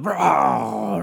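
sputtering engine noise ending in a bang; imitated backfire. Mower finally shutting off and backfiring, imitated engine sputter. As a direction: mouth the pops of a dying mower motor. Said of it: "and it's like".

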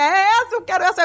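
decidida, em tom mais agudo; empolgada. Retold or imitated. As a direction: reencena o encanto à primeira vista, voz aguda. Conta paixão pela cachorra. (empolgação aguda)

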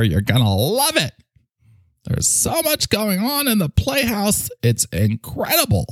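high put-on voice leaping into falsetto; playhouse character voice. Playhouse character inviting Mike over, excitable falsetto-laced voice. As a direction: coax eagerly, squealing on the big moments.

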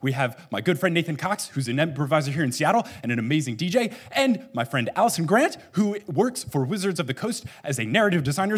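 ordinary delivery

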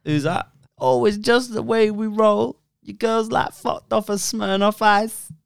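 slow, high imitation of the singer. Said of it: "is it [name]?".

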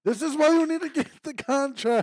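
loud, high-pitched insistence; real exasperated outburst. Real, not acted. Friend scolds coworker over missing contract, loud exasperation. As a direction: raise the voice sharply to drive it home.

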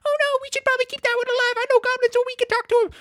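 very high, rapid, loud character voice; squeaky Henley voice. Retold or imitated. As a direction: pitch it way up and rattle it out.

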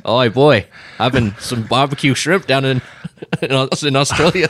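put-on jokey, stumbling Australian accent; playful Australian twang. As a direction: put on an Aussie accent, laughing partway through. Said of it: "speaking in an Australian accent".

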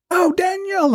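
mock call in a very high voice. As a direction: hail someone across the room, high-pitched. Pilot calling across headquarters.

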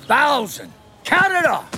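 drill sergeant. Acted. Logan drilling students after warning.

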